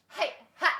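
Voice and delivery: ordinary talk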